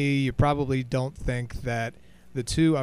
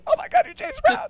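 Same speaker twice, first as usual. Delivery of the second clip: much higher, louder starstruck squeal; squealing fangirl; 5 dB louder than usual. Retold or imitated. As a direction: squeal it like a star-struck fan. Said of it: "your voice gets very high-pitched".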